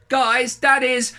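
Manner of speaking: high, loud, drawn-out call to the family; excited shout. A narrator's reading. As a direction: shout it out to tell on Dad. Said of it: "I shouted".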